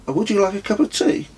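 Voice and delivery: high, fast, polite offer; character voice. A narrator's reading. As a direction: chirp the offer quickly, a bit awkward. Narrator voices himself offering tea.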